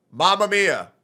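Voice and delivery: Mamma Mia said with no feeling at all; imitating a lifeless line read; mock emotionless. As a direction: rattle off Mamma Mia like it means nothing. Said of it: "There's no emotion in it".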